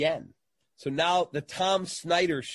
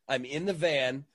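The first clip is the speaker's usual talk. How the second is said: ordinary delivery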